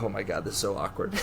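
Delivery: quick, hushed aside to yourself; awkward inner voice. Retold or imitated. Waving at a girl with headphones, hushed awkward mutter. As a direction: mutter it fast, cringing. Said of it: "I was like".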